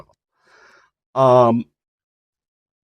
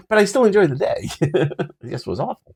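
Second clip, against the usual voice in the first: laughing; 7 semitones higher, 3 dB louder than usual. Real, not acted. Laughing about tripping over everyone.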